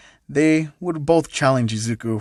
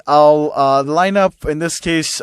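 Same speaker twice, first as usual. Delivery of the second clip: ordinary delivery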